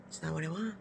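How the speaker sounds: retold quiet complaint; muted objection. Woman objects to partner's massage, quietly muted.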